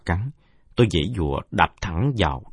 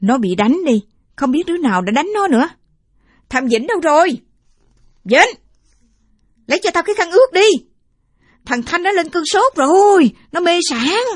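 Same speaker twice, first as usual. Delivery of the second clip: much higher, louder, anxious grandma calling; worried old woman; 9 dB louder than usual. Acted. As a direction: call for help over the feverish boy. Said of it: "rõ là tiếng của bà Ái". (giọng bà cụ hốt hoảng gọi)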